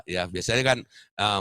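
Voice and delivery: ordinary delivery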